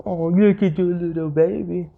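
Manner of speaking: teasing. Retold or imitated. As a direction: coo at the baby to needle her.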